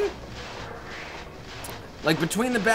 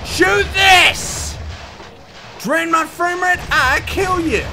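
shout; 9 semitones higher, slower than usual. Real, not acted. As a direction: yell it at the monster. Yelling at enemies in game.

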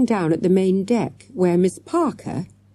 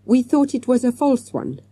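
put-on higher, mildly apologetic child's voice; meekly; 8 semitones higher than usual. A narrator's reading. Akbar owning up about the beard, mildly meek. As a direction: explain it meekly, pitched up. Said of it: "said Akbar meekly".

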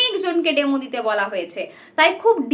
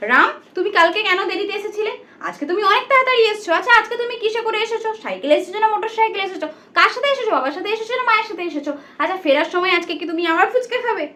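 rapid-fire run of questions, pitched up; too fast; 4 semitones higher, faster than usual. Shown as a demonstration. Teacher shows pupils hurried sentences, too fast. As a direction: rattle the questions off without pausing, higher pitch. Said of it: "আমি এত তাড়াতাড়ি কেন বলছি".

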